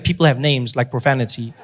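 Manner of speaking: ordinary delivery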